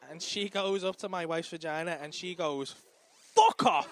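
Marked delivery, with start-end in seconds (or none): 3.3-3.9 much louder, higher shout; forceful shout. Retold or imitated. Nurse pulling the baby with tongs, loud shout. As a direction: heave it out with force.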